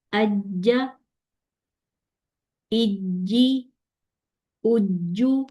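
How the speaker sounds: "اجی، اجو" with held double j and long vowel; careful tashdeed model; doubled jeem. Shown as a demonstration. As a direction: hold the j, then move straight into the vowel. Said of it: "تشدید میں بھی ادا کرنا ہے".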